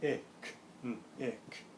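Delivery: picnic broken into separate sounds "ick, n-, ick"; segmented "Ick, n-, ick" for blending practice; sounding out. Shown as a demonstration. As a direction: say "Ick, n-, ick" in pieces with gaps. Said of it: "little bit faster".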